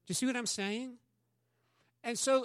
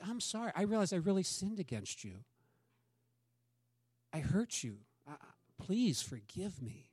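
pleading apology; 6 dB quieter than usual. Acted. Acted apology to hurt friend.

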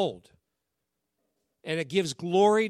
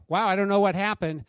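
louder, higher-pitched astonished doctor; amazed; 4 semitones higher, 7 dB louder than usual. Retold or imitated. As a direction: act out a doctor's loud amazement. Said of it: "He's amazed".

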